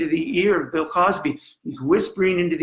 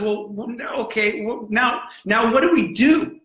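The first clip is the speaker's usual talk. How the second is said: worked-up questioning, pitched high; Machiavelli; 7 semitones higher than usual. Retold or imitated. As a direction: voice the questions with mounting urgency, climbing in pitch. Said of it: "Machiavelli says".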